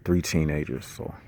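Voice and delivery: low, hushed voice; quieted down. Real, not acted. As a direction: keep it down so passers-by don't overhear. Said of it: "I'm gonna quiet down".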